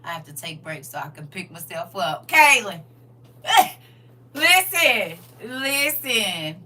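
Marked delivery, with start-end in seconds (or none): 2.3-6.6 calling out. Real, not acted. Calling out over laughter.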